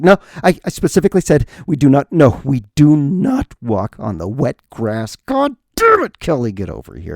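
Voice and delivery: put-on loud furious scolding of a kid; angry parent yelling. Angry parent scolding child Kelly at drop-off, loud furious yell. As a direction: chew out your kid over wet grass. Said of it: "the parent's just like".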